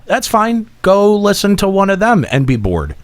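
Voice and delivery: ordinary delivery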